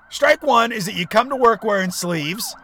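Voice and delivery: ordinary talk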